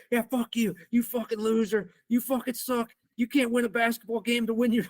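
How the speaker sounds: high-pitched retold tirade; going nuclear. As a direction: re-enact a student blowing up at the coach. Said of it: "I just went nuclear on him".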